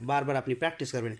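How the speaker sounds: ordinary talk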